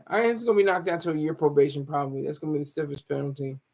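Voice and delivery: normal talking voice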